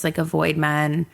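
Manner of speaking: ordinary talk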